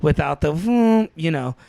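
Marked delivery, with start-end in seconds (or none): 0.5-1.1 high-pitched "vroom" noise; imitated machine whir. Traveler describing the airport X-ray machine, imitated whirring vroom. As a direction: mimic a scanner swooping up in pitch.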